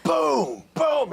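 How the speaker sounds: booming, punched-out hits; imitated sledgehammer blows. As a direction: boom it out like heavy blows. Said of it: "sounded like someone was hitting my house".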